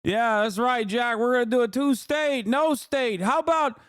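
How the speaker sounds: put-on very high, strained old-man caricature; hammed-up senile president impression; Joe Biden. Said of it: "and then Joe Biden would go".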